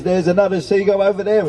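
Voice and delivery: high, urgent false warning; mock alarm. A narrator's reading. Daughter winding up Mum about seagulls, mock alarm. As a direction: raise the pitch as if spotting danger.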